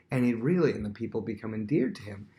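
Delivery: ordinary talk, nothing marked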